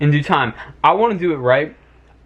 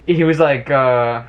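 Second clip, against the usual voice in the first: ordinary talk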